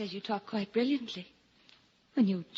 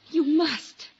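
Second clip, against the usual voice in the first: louder, higher plea; insist, pressing hard; urgent insistence; 6 semitones higher, 8 dB louder than usual. Acted. Lucy insists Carton must change, urgently.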